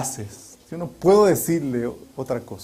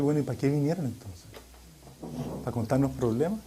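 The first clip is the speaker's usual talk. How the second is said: quick, softer, wry retort; mock exasperation; 3 semitones lower, 8 dB quieter, faster than usual. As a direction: tease them with a fast, ironic question. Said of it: "Yo decía".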